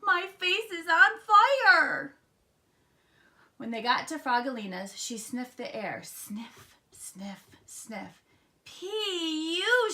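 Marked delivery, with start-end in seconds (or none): 0.0-2.2 high, loud, drawn-out wail; panicked yell. A narrator's reading. Froggy stung by aftershave, high drawn-out yell. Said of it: "he yelled". 6.0-8.2 soft, airy little words; sniffing. A narrator's reading. Frogalina testing the air, soft sniffy words. 8.6-9.9 high, loud, stretched-out reaction; disgusted. A narrator's reading. Frogalina smelling aftershave, high loud disgust.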